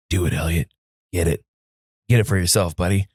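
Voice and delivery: low, hushed-pitch pep talk to a buddy; encouraging urging. Retold or imitated. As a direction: urge him on, low and earnest. Retold private pep talk to friend.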